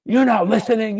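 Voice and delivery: imitating the boss laying into him; angry yell. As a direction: bark it at him like a furious boss.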